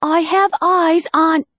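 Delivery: high, loud, thrilled announcement; excited cry. Acted. Bex shouts excitedly into the earpiece to her friends. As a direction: burst out with the big news. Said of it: "as Bex cried".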